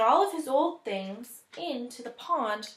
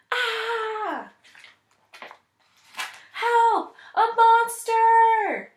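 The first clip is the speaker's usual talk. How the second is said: high, loud panicked cry for help; frightened king shrieking for help; terrified scream; 12 semitones higher, 10 dB louder, much slower than usual. Acted. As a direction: scream like you've seen a monster. King sees scary monster.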